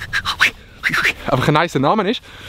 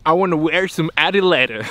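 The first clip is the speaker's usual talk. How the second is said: put-on American accent. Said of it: "Amerikaner sagen".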